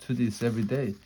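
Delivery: plain reading voice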